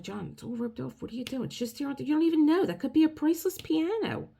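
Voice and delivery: frantic warning to her husband, climbing in pitch; wife panicking as husband ducks under the rope; alarmed pleading. Retold or imitated. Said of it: "I'm like".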